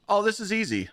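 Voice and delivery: mock breezy agent. Mocking the airline agent's breezy reply.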